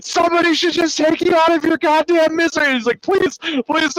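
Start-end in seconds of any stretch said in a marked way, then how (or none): 0.0-2.7 high-pitched, exasperated mock tirade; fed-up villain rant. Villain aiming a rifle at his harmless nemesis, fed-up rant. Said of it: "so fed up with it". 3.0-4.0 mock pleading. Harmless old man begs at gunpoint.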